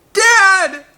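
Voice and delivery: high, loud scream for his father; desperate scream. A narrator's reading. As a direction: scream in despair. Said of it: "Eric screamed".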